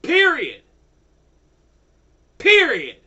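loud, hard final word; angry shout. Real, not acted. Furious ranter shouting at the lobby group. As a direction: slam the word down in fury.

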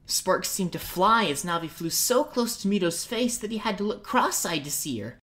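ordinary delivery

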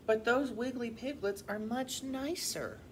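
lively, expressive picture-book reading; animated storybook voice; children's story narration. A narrator's reading. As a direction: read to young children with clear, lilting emphasis. Reads a picture book aloud.

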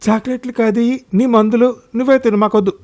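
put-on high-pitched children's refusal; disgusted children. A narrator's reading. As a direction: push it away like upset kids, voice high. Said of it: "ముఖం నల్లంగా పెట్టుకుని". (చిరాకుగా పిల్లల్లా నిరాకరిస్తూ)

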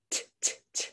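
"T, t, t" as short unvoiced puffs; isolated t sound. Shown as a demonstration. Teacher showing the last sound of "feet", unvoiced t. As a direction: tap out the final t sound. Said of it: "Make that last sound".

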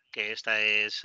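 ordinary talk

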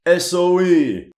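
slow, high and loud, spelling out each letter; thrilled to see the unit named; excited recognition. Real, not acted. Historian spotting SOE in the cutscene, slow excited call.

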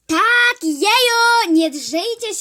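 high, very loud yell at the others. Acted. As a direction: yell over the whole class. Kid yells at classmates.

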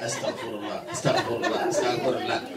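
low, soft repeated muttering; demonstrated mumbling. Shown as a demonstration. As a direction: mumble it under the breath, barely moving the mouth. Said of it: "mouth mumbling like that".

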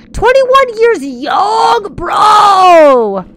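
high, drawn-out hyped cry; big high-pitched cheer; celebratory shout. Real, not acted. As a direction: holler it slowly to hype the birthday. Celebrates viewer's birthday.

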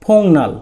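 ফোংনাল said carefully, with ng before the n; demonstrated pronunciation. Shown as a demonstration. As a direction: model ফোংনাল clearly as a Korean word for learners. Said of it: "শব্দটির উচ্চারণ হচ্ছে".

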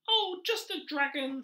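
rat character. Acted. Teacher voicing the pet-shop rat to children, high and loud. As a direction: play the pushy little rat, high and loud.

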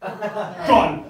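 sharp gasp, loud word; mock gasp then loud gone. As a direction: act the crowd gasping. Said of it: "was like".

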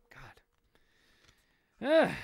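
plain reading voice

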